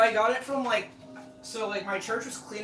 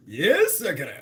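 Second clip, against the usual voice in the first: usual speaking voice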